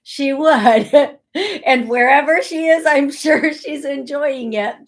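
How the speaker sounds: real laughing. Real, not acted. Picturing mom enjoying it, laughing as she speaks. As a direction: say it through a fond laugh.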